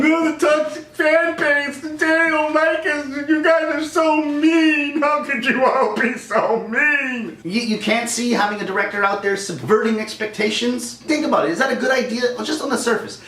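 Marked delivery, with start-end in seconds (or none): 0.0-7.4 high, slow whining complaint; whiny mock filmmakers. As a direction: whine it high and drawn out like a hurt child. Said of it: "you guys are sitting there going".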